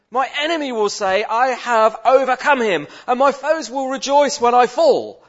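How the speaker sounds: loud, high, impassioned cry to God; anguished psalm reading. Shown as a demonstration. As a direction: demand an answer from God at full voice. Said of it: "we're gonna demonstrate to you how easy it is".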